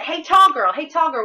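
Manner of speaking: imitating the coach calling a player. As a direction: yell across the diamond. Coach shouting across the field.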